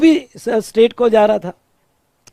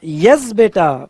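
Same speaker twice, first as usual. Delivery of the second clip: usual speaking voice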